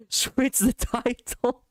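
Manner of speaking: high, slow, breaking up with laughter; laughing while retelling. Real, not acted. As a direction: struggle to get it out through laughter. Cracking up while retelling the request.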